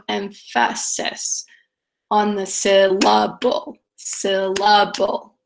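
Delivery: very slow "em-FA-sis", "sil-LA-ble", second syllable weighted; iambic bend. Shown as a demonstration. Teacher demonstrates hearing rhythm, slowly bending "syllable" to sil-LA-ble. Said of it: "an iambic bend".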